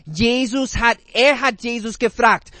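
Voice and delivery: ordinary delivery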